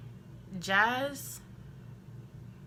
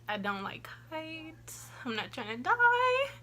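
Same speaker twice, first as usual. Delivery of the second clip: high-pitched, drawn-out whine; mock panic; 8 semitones higher, slower than usual. Patient dreading an airlift in her head, mock-panicked whine. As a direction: wail it in comic dread, voice climbing.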